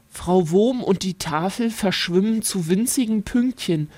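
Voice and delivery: usual speaking voice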